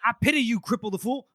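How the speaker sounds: high, loud catchphrase impression; Mister T. Podcaster retells a prisoner taunting his captor, mock tough-guy impression. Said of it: "He just goes Mister T on him".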